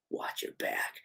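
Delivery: whispered threat; Ghostface. Acted. Killer ends the call to Gail, menacing whisper. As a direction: whisper it as a parting warning. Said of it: "getting a call from Ghostface".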